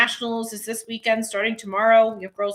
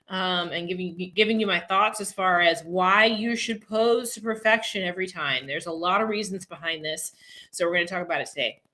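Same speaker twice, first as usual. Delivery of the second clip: ordinary talk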